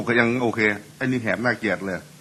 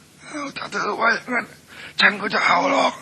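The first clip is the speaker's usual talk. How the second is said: much higher strained croak, slowed; hoarse husband voice; 12 semitones higher than usual. Retold or imitated. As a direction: agree in a cracked hoarse squeak. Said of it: "เมียก็บอก". (ผัวเสียงแหบตอบเมีย)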